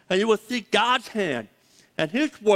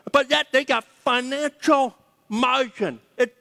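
higher, driving preacher voice, building on each "better"; full-force preaching; 6 semitones higher than usual. Real, not acted. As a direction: preach it home to the congregation, fired up.

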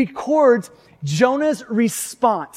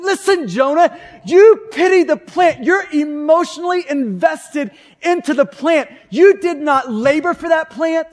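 rising preacher's rebuke with a shout on the plant; God rebuking Jonah; 4 semitones higher than usual. Acted. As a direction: press it on Jonah like a scolding father.

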